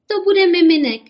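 high, loud jubilant cry; joyful cheer. A narrator's reading. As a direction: cheer it out like happy mothers. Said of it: "jásaly maminky". (jásavé zvolání)

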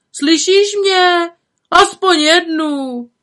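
high, loud, drawn-out pleading call; calling over heads. A narrator's reading. Jakub begs the flower girl for flowers from afar, calling out. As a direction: stretch the words to carry across a crowd. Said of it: "volal lidem přes hlavy".

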